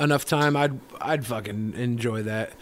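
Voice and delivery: usual speaking voice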